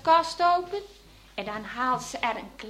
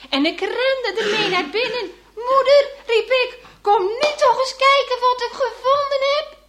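much higher and louder, breathless with excitement; excited calling; 11 semitones higher, 10 dB louder than usual. Retold or imitated. Father running in with the foundling, calling mother excitedly. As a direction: shout for mother in delight.

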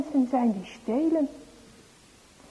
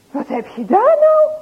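much higher and louder, surprised; surprised mother; 11 semitones higher, 9 dB louder than usual. Acted. Mother surprised at boys with a sack. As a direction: ask it wide-eyed as mother.

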